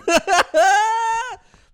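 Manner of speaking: big burst of laughter; loud laugh. Real, not acted. Laughs at own joke.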